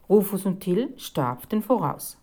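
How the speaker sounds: plain reading voice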